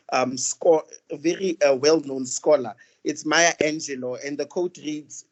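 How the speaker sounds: normal talking voice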